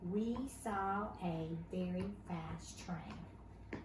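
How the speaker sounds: slow, deliberate reading of a sentence; slow read-aloud sentence. Shown as a demonstration. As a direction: read slowly and clearly, ending flat. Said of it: "it ends with a period".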